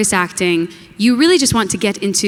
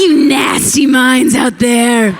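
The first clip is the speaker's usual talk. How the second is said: slow mock scolding; teasing; 3 semitones higher, 4 dB louder, slower than usual. Real, not acted. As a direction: call out the crowd playfully. Teasing the audience.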